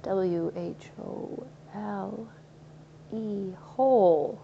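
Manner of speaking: W H O L E spelled letter by letter very slowly, then whole said in full; slow spelled W H O L E before the word whole; spelling it out. Shown as a demonstration. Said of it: "a place that is completely whole".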